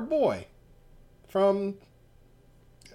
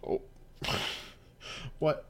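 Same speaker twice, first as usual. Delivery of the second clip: ordinary delivery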